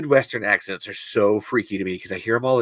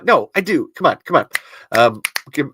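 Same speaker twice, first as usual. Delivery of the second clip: ordinary delivery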